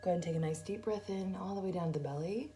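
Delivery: soft, even meditation voice with long pauses; guided meditation. Acted. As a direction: guide them gently through each breath, hushed and unhurried. Leads a guided breathing meditation.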